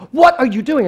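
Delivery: loud, high-pitched demand; angry mother. Retold or imitated. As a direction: imitate a mom bursting in to confront her kid. Mother charges upstairs, demanding.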